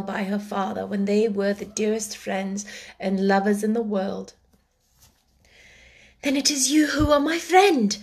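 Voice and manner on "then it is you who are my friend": higher, touched first realization of who he is; moved realization. Acted. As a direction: say it as it dawns on you, moved. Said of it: "she said".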